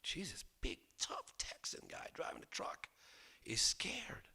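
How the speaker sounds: hushed mocking whisper; breathy hushed aside. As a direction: whisper it behind his back. Aside about scared tough Texan.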